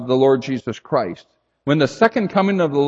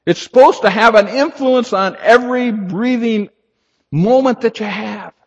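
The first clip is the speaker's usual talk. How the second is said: fervent exhortation; 7 semitones higher, 5 dB louder than usual. Real, not acted. Preacher rising to exhortation.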